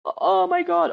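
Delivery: very high, wailing cry; imitated kid's distressed squeal; hammed-up high-pitched dismay; mock child panic. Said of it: "falling over".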